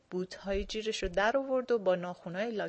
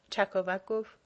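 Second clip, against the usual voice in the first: plain reading voice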